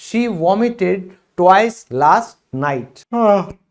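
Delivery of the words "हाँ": short open-mouthed yawn; demonstrated yawn. Shown as a demonstration. Teacher acting out the word for learners, yawning. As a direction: let out a put-on yawn. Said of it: "मैंने उबासी ली".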